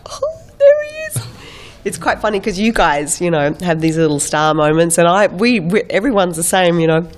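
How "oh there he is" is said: tiny, very high, quick squeal; fangirl squeal. Acted. Fangirl spotting an actor in yoga class, high squeal. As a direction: squeal it fast, as if sneaking a look. Fangirl sneaking peek at Colin Farrell.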